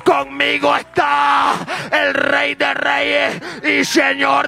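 preaching at full force. Acted. Preacher urging the church to fight back, at full force. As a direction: declare victory as a fiery preacher.

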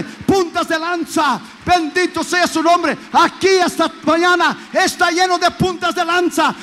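rally the congregation to mission; full-force preaching. Acted. (predicación a todo pulmón)

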